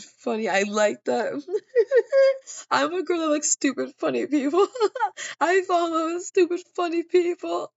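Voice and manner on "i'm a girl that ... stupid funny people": higher, louder, laughing through the words; real laughter breaking through; giggly. Real, not acted. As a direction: gush while laughing. Laughing while gushing.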